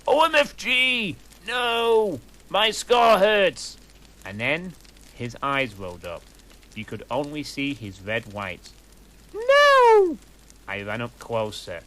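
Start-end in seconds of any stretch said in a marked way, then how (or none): 0.1-3.8 high, loud pained cry, scar slowed; screaming in pain. A narrator's reading. Vampire cries out as his scar burns. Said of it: "he started to scream". 9.3-10.2 very high loud shriek; panicked cry. A narrator's reading. Narrator shrieks as Vampire collapses. Rushing to his collapsed friend.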